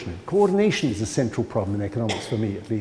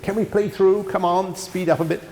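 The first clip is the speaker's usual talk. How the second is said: mildly acted impatience, pitched up; impatient upset; 7 semitones higher than usual. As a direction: urge them on, voice raised in pitch. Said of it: "started to get very upset".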